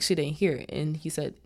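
ordinary delivery

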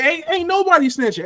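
mock innocent denial. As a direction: deny it, voice jumping up. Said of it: "He said".

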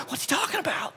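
put-on high, hushed, puzzled kid voices; kids in the van. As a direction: whisper like confused children in the back seat. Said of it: "all the kids in the back of the van going".

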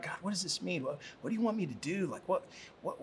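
soft, puzzled private prayer, hurried in the middle; murmured prayer. Acted. Peter prays about the vision, softly confused. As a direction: ask God under your breath, acting confused. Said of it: "Maybe he's even praying about it".

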